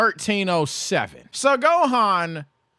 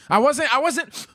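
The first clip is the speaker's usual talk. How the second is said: ordinary talk, nothing marked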